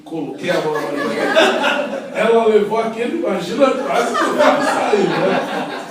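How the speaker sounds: loud, high-pitched telling through laughter; amused exclaiming. Real, not acted. Recounting to an audience how she startled the woman, amused exclaiming.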